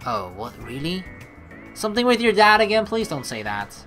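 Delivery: usual speaking voice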